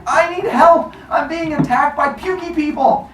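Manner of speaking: high, hammed-up panicked yell; yelling for help. Cronus yelling for his friends, high mock panic. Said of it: "starts yelling for his friends".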